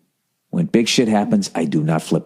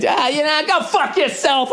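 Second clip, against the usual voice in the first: mock insult. Final insult to the driver.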